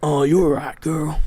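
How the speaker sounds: loud cowboy talk to a dog; Western accent. Retold or imitated. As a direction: drawl it like a ranch hand. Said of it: "I'm doing that shit".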